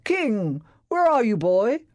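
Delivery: high, loud call for the dog. A narrator's reading. Master searching for his dog, calling loudly. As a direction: call into the passageway, worried. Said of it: "he called".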